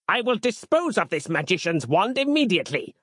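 high, restrained-volume bellow; high theatrical zealot's proclamation. A narrator's reading. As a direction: declare it with fanatical outrage. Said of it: "bellowed Harland".

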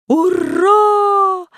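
high, loud shouted hurrah. Acted. Crowd forced to cheer, loud high shout. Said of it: "уже громче крикнула толпа".

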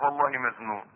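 low, soft plea of a hard-up borrower; debtor pleading for time. Acted. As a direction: beg quietly for another month, voice dropped. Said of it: "وقال لك".

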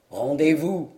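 a victor ordering surrender, low; dwarf demanding. Acted. Dwarf over the fallen knight, low command.

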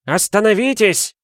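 high, loud call to stop; shout from afar. A narrator's reading. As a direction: cry out as if from the shore. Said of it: "раздался крик с берега". (крик издалека)

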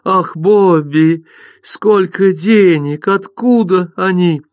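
high, slow, thrilled gasp; like a game show winner. Acted. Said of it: "голос у неё был словно у победительницы шоу".

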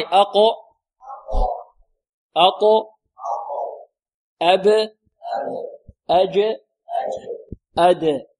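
leading the class in the qalqala letters; qalqala drill. Shown as a demonstration. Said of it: "انطقوا معي".

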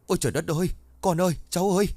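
grandmother crying out in grief, voice climbing high; wailing scream. A narrator's reading. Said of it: "tiếng của bà Sâm gào thét lên". (gào thét thảm thiết, giọng cao vút)

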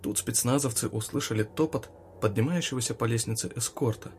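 usual speaking voice

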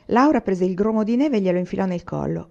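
ordinary delivery

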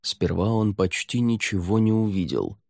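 usual speaking voice

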